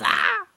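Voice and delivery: sudden high, loud cry; loud "ah" yell. Pretend. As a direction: let out a short loud yelp. Yell after breathless run.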